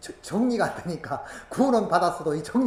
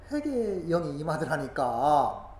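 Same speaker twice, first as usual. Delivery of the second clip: normal talking voice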